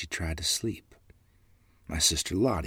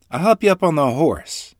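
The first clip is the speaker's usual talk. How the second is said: higher and louder, helpful mother; mother's voice; 10 semitones higher, 10 dB louder than usual. A narrator's reading. Mother helps her children up on the horse, higher and louder. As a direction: lift them up with a cheerful offer.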